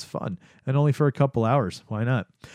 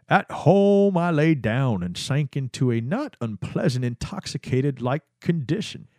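Albert Hofmann voice; 2 semitones higher than usual. Retold or imitated. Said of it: "I'm gonna do something different".

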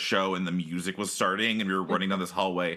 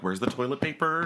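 put-on higher, searching kid's question; anxious kid searching; 7 semitones higher than usual. Kid sent for toilet paper, starting to worry. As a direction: scan the aisles, getting worried.

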